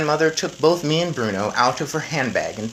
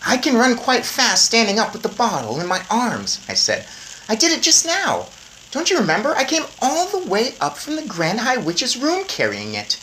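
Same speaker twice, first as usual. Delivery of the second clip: put-on lightly higher boy voice; boy mouse; 10 semitones higher, 4 dB louder than usual. A narrator's reading. Boy reminding grandmother, light eager boy voice. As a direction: point it out eagerly.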